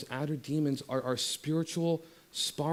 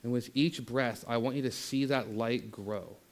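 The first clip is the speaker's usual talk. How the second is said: gentle guided visualization, voice softer on each line; guided meditation; 3 semitones lower, 5 dB quieter than usual. Acted. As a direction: ease them into stillness, barely above a breath.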